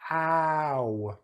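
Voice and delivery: ow like a light bump on the head; a playful little ouch; mock pained ow. Shown as a demonstration. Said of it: "like you hurt your head".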